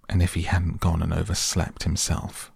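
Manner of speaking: ordinary delivery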